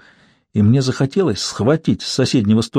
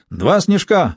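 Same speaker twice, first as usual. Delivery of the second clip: high, brisk order call; mild bellow; 7 semitones higher, much slower than usual. A narrator's reading. Julian orders more cocktails from the bar, mild bellow. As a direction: call it out high, only moderately forceful. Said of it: "гаркнул Джулиан".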